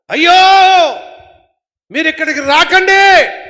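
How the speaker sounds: high, loud desperate cry; shouted warning. Acted. Souls in hell warning the living, shouted cry. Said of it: "ఏమని అరుస్తున్నారు?".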